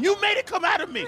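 high mock-indignant blame; comic aggrieved cry; accusing outburst. Blaming partner after outburst.

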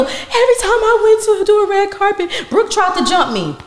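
high, laughing mock whine of a rival; mocking her claim. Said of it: "she goes around telling people".

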